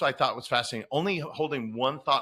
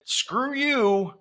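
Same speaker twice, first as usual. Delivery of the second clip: higher, louder defiant comeback; defiant retort; 10 semitones higher, 5 dB louder than usual. Acted. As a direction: tell off the inner critic, drawn out. Defiant reply to inner critic.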